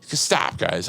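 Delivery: softly acted protest, dropping away; weary plea. Storyteller replays asking coworkers to quit, soft weary plea. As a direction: plead under your breath, falling in pitch.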